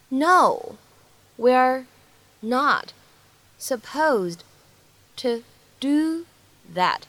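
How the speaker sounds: slow, careful one-word-at-a-time delivery. Shown as a demonstration. Teacher breaking the reply down for learners, word by word. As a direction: isolate every word, pausing after each.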